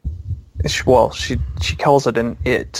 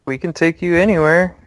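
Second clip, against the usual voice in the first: put-on higher-pitched little girl; lightly voiced small girl, pitched up; 6 semitones higher than usual. Girl offering to guide the visitors, lightly raised child voice. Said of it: "the little girl goes".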